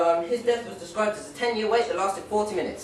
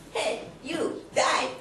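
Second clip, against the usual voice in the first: mock hunter threat; 8 semitones higher, much slower than usual. Hunting gun quote.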